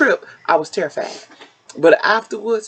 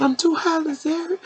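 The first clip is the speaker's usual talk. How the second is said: scared; 11 semitones higher, 3 dB quieter than usual. Retold or imitated. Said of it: "I said".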